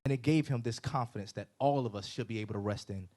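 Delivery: usual speaking voice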